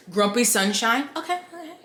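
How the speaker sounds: ordinary delivery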